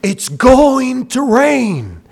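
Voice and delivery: crying out, pleading, loud and high; impassioned street preacher; open air preaching. Retold or imitated. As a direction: plead with the whole village. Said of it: "And just preaching".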